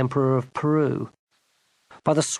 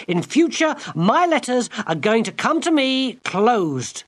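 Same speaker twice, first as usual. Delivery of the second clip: high, slow angry command; girl commanding the enchanter in fury; angry demand; 10 semitones higher, 3 dB louder than usual. Acted. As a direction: lay down the law furiously. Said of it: "Gwendolen shouted at him".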